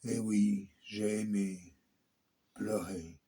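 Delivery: slow, measured declamation with long pauses between phrases; solemn poem recitation. Acted. Performer reciting a Holocaust victim's poem to listeners, solemn and slow.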